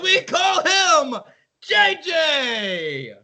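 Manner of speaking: rising ring announcer call; grand entrance announcement. Pretend. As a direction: hype the name for the crowd. Announcing little brother entrance.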